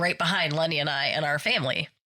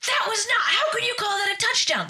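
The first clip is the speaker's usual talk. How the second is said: angry coach yell. Retold or imitated. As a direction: rage at the ref like a coach losing it. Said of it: "He's like".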